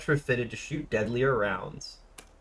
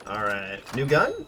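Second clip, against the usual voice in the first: ordinary talk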